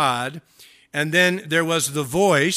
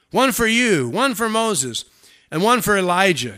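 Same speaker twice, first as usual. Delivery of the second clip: high, loud, sing-out listing; eager proposal; 5 semitones higher, 6 dB louder than usual. Acted. Peter offers Jesus and guests a shelter each, eagerly. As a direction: count them off loudly and eagerly. Peter handing out tabernacles, acted.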